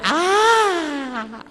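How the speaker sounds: high squeal of fright; mock scream. Preacher imitating a macho man spotting a rat, squealing.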